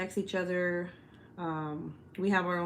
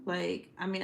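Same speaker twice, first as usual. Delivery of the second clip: usual speaking voice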